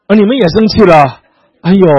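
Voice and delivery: loud startled exclamation; mock alarm at listeners; surprised. Real, not acted. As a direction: jump at the crowd's reaction. Preacher reacts to audience.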